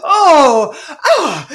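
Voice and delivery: loud, high, hammed-up shock; mock shocked gasps. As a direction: overplay the fake surprise, loud and high. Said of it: "And they're all like".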